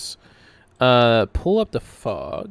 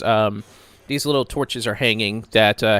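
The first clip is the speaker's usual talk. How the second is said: ordinary talk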